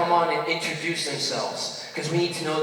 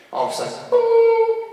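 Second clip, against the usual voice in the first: mock crash boom; 5 dB louder than usual. Turbulence noise.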